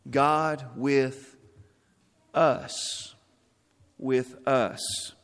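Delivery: "us" breathed out soft after a pause; quiet, slow emphasis on "us" in "God with us"; stress on us. Shown as a demonstration. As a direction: hold back, then land on "us". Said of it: "this third word of this name".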